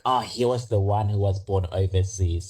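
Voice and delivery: usual speaking voice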